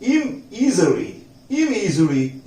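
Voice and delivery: इमइजरी drawn out syllable by syllable; slow careful model. Shown as a demonstration. Teacher slowing down so students catch the word. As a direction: say इमइजरी very slowly. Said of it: "उसके बाद है इम".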